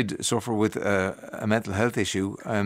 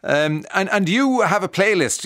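ordinary delivery

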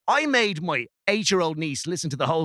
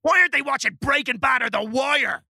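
indignant rant; 8 semitones higher than usual. Acted. [name] mocking young viewers' taste.

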